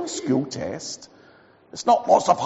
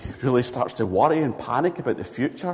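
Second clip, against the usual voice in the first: ordinary talk, nothing marked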